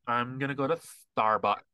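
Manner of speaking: put-on slow, lazy LA accent, Kardashian-style. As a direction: drag the words out in a Valley girl LA accent. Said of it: "they have that accent".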